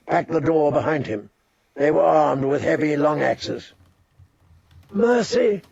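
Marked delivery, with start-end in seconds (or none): 4.9-5.6 high desperate cry for mercy; cried out. Acted. Catelyn crying out to the Freys for mercy. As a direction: cry it out, pleading. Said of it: "Catelyn cried".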